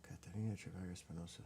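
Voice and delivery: quick whisper of the names; murmured reading under the breath. Real, not acted. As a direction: whisper the names to yourself, fast. Reading record names to herself.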